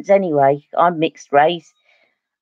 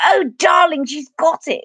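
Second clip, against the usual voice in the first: high, gushing posh teacher; mock-grand delight, pitched well up; Yvonne Darling; 8 semitones higher than usual. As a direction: imitate an elocution teacher thrilled with a pupil. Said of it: "She go".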